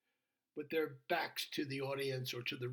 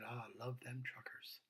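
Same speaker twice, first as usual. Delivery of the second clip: tender whisper; 2 semitones lower, 9 dB quieter than usual. Retold or imitated. Aside to the audience.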